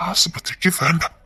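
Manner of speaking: slow, soft whispered plea; pleading whisper. Acted. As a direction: beg it under your breath. Husband pleads for secrecy.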